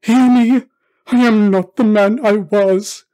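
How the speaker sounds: desperate cry. Acted. Scrooge clutching the spirit's robe, crying out desperately. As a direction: beg loudly to be heard. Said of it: "he cried, tight clutching at its robe".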